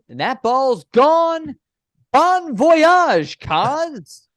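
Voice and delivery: high, drawn-out announcer's cry; home run call. Retold or imitated. Broadcaster retelling his first signature call, excited home run call. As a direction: belt out the call as the ball leaves the park.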